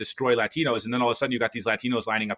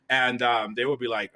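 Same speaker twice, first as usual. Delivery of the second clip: normal talking voice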